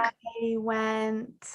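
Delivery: soft, drawn-out attempt at answering; struggling student. Student keeps trying as teacher interrupts, soft and hesitant. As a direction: trail the words out, still searching.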